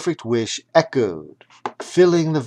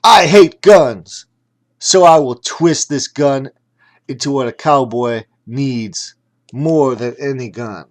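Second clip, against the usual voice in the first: cowboy shouting his vow; calling out; 3 semitones higher, 10 dB louder than usual. A narrator's reading. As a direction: boom it out, then ease off. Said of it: "The Kung Fu cowboy called out".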